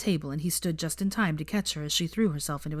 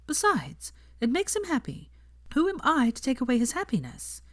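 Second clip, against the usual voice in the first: primly; 8 semitones higher than usual. A narrator's reading. Hermione correcting Ron about the elf, primly. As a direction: set it straight, prim and proper. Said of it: "said Hermione primly".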